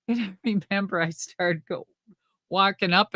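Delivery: ordinary talk, nothing marked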